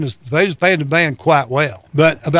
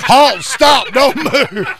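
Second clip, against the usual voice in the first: loud, high "Halt, stop, don't move."; mock police shout; 9 semitones higher, 6 dB louder, much slower than usual. Bit actor shouting his new speaking role, mock shout. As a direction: bellow it like a proud new cop.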